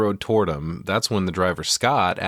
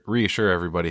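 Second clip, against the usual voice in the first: ordinary talk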